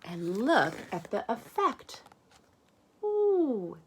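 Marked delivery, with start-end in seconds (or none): none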